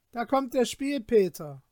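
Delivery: mocking call. Villagers mock Peter from windows.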